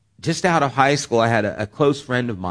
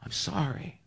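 tender apology; 4 semitones higher, 7 dB quieter than usual. Retold or imitated. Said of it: "And I just wanna say".

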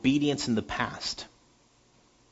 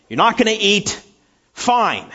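loud, higher fed-up father snapping; furious father; 9 semitones higher, 8 dB louder than usual. Retold or imitated. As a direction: slam it down like an angry dad. Furious father throws spoon.

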